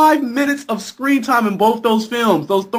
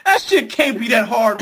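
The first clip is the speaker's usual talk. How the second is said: ordinary delivery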